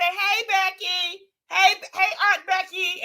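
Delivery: hammed-up family-reunion greeting; mock greeting. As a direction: sing out the greeting up high.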